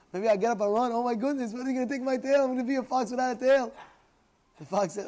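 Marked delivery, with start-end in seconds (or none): 0.1-3.7 high, loud mock panic; panicking fox. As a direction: fret loudly like a cornered fox. Acts out fox fearing for tail.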